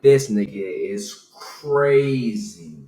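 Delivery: very slow, stretched-out exasperated reaction; drawn-out disbelief. Retold or imitated. Storyteller on his beer-drinking neighbor, slow incredulous drawl. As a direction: drag the words right out in disbelief.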